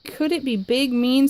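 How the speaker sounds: usual speaking voice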